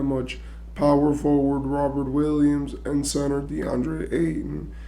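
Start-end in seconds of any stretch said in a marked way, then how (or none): none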